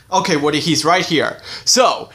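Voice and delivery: ordinary talk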